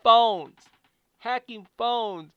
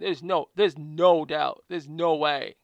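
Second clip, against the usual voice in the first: ordinary talk, nothing marked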